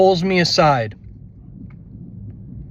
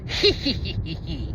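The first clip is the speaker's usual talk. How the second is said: evil giggle; 5 dB quieter than usual. Retold or imitated. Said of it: "it was like".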